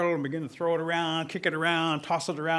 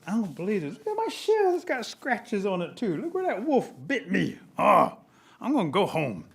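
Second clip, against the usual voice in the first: whining turtle; 3 semitones higher than usual. Acted. Turtle inspecting his scratched shell, comic aggrieved whine. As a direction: bemoan it with pitch jumping up, then give up.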